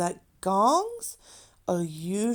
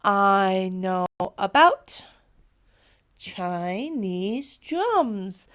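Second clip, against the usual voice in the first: slowed, drums drawn out; slow repeat for the class; slow model sentence; slower than usual. Shown as a demonstration. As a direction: model it slowly once more. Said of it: "One more time".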